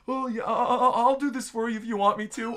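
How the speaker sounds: lightly voiced shy, stammering monster; nervous stammer with a timid laugh; shy stuttering. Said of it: "He starts stuttering and he's like".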